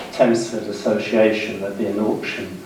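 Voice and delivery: normal talking voice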